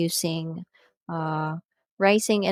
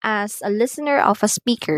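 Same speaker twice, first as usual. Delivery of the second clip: ordinary talk, nothing marked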